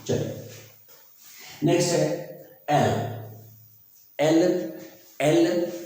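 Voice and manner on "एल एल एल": एल ending on a clean l; एल with no 'la' at the end; no final LA. Shown as a demonstration. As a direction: say एल without adding a vowel after the l. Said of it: "ला जो sound है, वो नहीं आना चाहिए".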